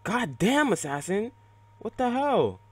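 high, loud exasperation; outraged yell. Real, not acted. Gamer at the enemy assassin, outraged yell. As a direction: yell it in high-pitched disbelief.

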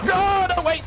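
high, loud, sudden alarm; panicked cry. Real, not acted. Player panicking as the character slips, high alarmed cry. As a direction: cry out as it all goes wrong.

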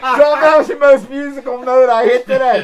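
loud, high words through real laughter; laughing. Real, not acted. Singer laughing at his own note to the crowd, laughing. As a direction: crack up while boasting. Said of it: "did you hear that sound".

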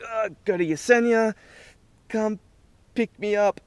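high, pleading, a bit loud; desperate plea. Retold or imitated. Tripping on mushrooms begging his partner for a ride, high pleading voice. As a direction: beg for rescue in a high strained voice.

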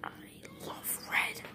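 whispering. Acted. Whispered confession.

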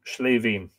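"Slavin" said the Irish way, "SHLAY-veen"; Irish pronunciation. Shown as a demonstration. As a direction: open "Slavin" on a sh sound and end on "veen". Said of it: "it's from the Irish".

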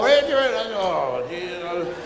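ordinary delivery

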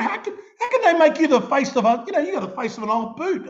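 laughing. Retold or imitated. Retelling joke to friend, laughing.

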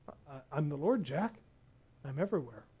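God speaking softly to a frightened man; calm soft divine voice. Acted. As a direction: answer gently, all-knowing.

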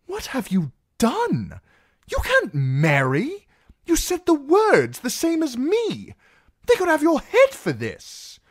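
very high, fretful scolding; loud, squeaky-high worried protest; anxious Sam, appalled. Acted. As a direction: scold a friend in horrified disbelief. Plays Sam scolding Darion.

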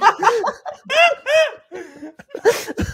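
laughing through the words, slowly; can barely get it out for laughing. Real, not acted. Cracking up at the video.

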